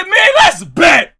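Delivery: high, loud dare; challenge shout. Retold or imitated. Repeating the dare to the player, shouting.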